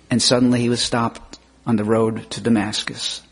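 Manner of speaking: normal talking voice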